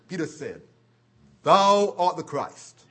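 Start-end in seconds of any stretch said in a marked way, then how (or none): 1.4-2.8 loud and half-speed, each word set down; loud proclamation. Retold or imitated. Peter declaring Jesus the Christ, slow and loud. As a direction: proclaim it slowly and loudly.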